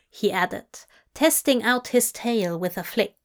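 ordinary delivery

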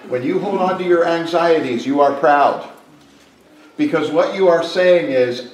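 loud, driving pulpit delivery; preaching at full force. Acted. Pastor pressing the church on pride, loud preaching. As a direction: press the point home to the congregation.

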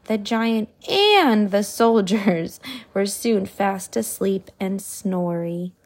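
ordinary delivery, nothing marked

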